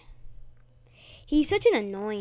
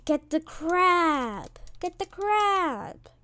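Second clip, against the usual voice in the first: drawn-out urging; 7 semitones higher, much slower than usual. Real, not acted.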